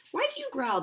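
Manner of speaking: high sad little bird voice; sad warbler. Acted. Warbler asking Jake sadly, high bird voice. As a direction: ask it as a worried little bird. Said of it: "looking sad".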